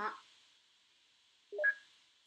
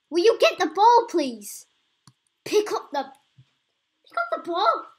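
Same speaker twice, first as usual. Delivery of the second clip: much louder, higher shouted plea, dragging out the last words; frustrated yell; 7 semitones higher, 14 dB louder, slower than usual. Real, not acted. Gamer yelling at his rider to pick up the ball, loud frustrated shout. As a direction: bellow at the character to grab the ball.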